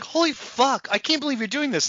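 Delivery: high-pitched exaggerated astonishment; mock disbelief. Voicing the annoyed viewer, mock disbelief. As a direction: react as if you can't believe it. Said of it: "You're like".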